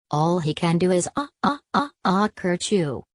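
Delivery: mock sneeze.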